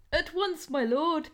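high squeaky hen voice; squeak. A narrator's reading. Hen eagerly serving the lion, squeaking high. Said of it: "Penny squeaked".

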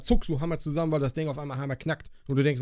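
ordinary talk, nothing marked

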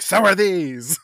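high, loud mock comeback; brazen retort. Lucy answering Harker with her cleavage, brazen mock shout. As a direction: belt it out as a hammy retort. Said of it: "being like".

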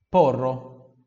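porro said loud and firm, rolled r; loud model word. Shown as a demonstration. Teacher models porro loudly for repetition. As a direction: call out porro for the class to repeat. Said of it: "Repita, porro".

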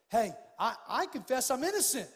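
mock courtroom plea. Preacher playing a defendant in court, loud mock plea. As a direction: plead innocence in court, hammed up. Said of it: "if I went to court and I said".

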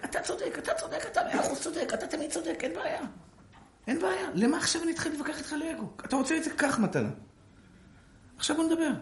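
high, laughing, falsetto-like giving in; mock appeasing. Rabbi mocking agreement to end an argument. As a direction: concede everything with a laugh. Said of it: "למה אתה מבזבז את הזמן על שטויות?".

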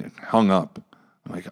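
ordinary delivery

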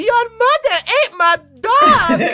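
a high, loud, laughing cry of outrage; outraged yell. Retold or imitated. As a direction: yell the accusation at the family, laughing through it. Son shouting at the family.

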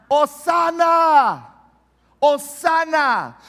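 slow, high, loud shout of praise; crowd shout. Retold or imitated. Pastor acting the crowd shouting to Jesus, loud and high. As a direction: cry it out like the crowd at the gate. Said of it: "¿Qué es lo que gritaban?".

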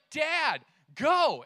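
lightly voiced impatient child; imitated bossy kid. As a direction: play a kid giving orders from the back seat. Voices bossy backseat-driver kids.

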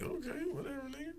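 very soft, half-whispered brush-off; hushed, dismissive aside; dismissive mutter. Retold or imitated. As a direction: brush them off under your breath. Recalls his dismissive private reply.

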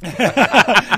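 bursting out laughing; big real laugh; loud laugh. Real, not acted. Drill noise gag makes him laugh.

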